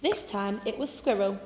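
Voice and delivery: plain reading voice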